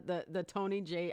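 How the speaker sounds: ordinary delivery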